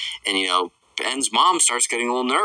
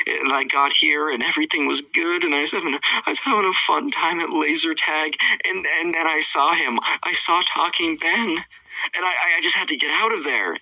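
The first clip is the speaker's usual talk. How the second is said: raised-pitch imitation of an upset kid; Trevor; 7 semitones higher than usual. Boy tells why he fled laser tag, mock upset. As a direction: recount it high, as a flustered little boy. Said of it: "he's like".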